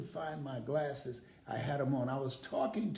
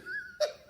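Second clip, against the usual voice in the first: burst of laughter. Real, not acted. Laugh after Prevagen.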